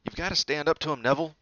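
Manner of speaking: loud, high insistence; insistent. Acted. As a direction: push him to fight back. Ron urging Neville.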